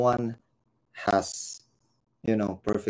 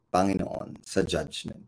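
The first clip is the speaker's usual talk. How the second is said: ordinary delivery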